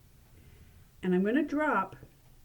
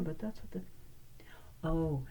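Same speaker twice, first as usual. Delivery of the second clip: normal talking voice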